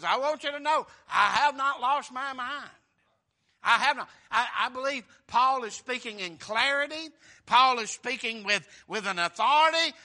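loud, high, driving sermon voice; fiery revival preaching; preaching at full force. Acted. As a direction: preach it out with fervor.

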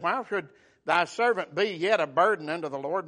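normal talking voice

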